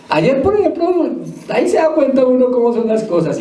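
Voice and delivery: high, lively telling of yesterday's match; excited recall. Real, not acted. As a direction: pitch it up, eager to tell. Excited football anecdote. (contando emocionado el partido de ayer, con voz alta y viva)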